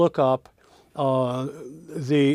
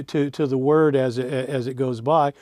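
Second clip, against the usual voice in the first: ordinary talk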